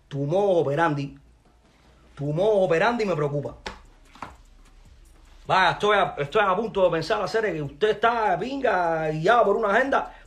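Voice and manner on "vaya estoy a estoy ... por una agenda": louder, higher, pressing hard; angry demand. Real, not acted. Pressing his rival for an answer, angry and loud.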